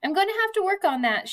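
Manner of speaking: a touch of a laugh. A narrator's reading. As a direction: toss it off with a little laugh. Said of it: "she says with a laugh".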